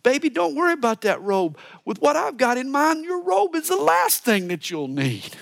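a hint of a keen lover brushing off excuses; eager husband. Preacher voices Solomon reassuring his wife, mildly eager. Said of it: "And Solomon's thinking".

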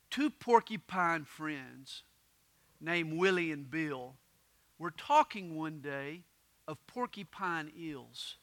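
slow, low, hushed storytelling; story poem narration. A narrator's reading. Preacher begins porcupine fable for congregation, storytelling. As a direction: open a rhyming tale softly and unhurriedly.

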